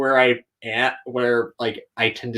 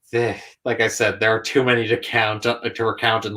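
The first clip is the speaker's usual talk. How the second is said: ordinary talk, nothing marked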